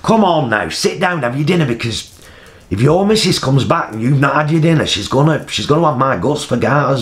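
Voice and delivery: high, hearty matey patter; jolly him along like one of the lads; blokey banter. Retold or imitated. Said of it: "I developed this".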